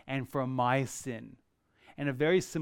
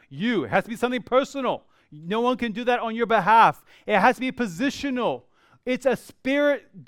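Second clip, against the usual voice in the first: rising, louder, quicker preaching; fervent preaching; 6 semitones higher, 6 dB louder than usual. Real, not acted. Pastor urging his congregation, louder and faster. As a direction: press the point home with building fervor.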